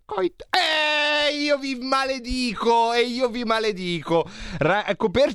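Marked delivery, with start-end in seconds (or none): 0.1-0.4 high squeaky quote; Roborta voice. Retold or imitated. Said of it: "mi dice". 0.5-4.2 mock curse. Mock curse, high pitched.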